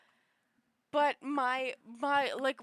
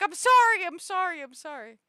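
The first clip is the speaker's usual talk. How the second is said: mock apology; 5 semitones higher, 10 dB louder than usual. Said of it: "And I'd be like".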